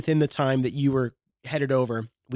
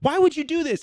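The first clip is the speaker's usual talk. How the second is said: high, louder indignant cry; retold mad; 13 semitones higher, 4 dB louder than usual. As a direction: squeal out the outrage at being set up. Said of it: "I was so mad".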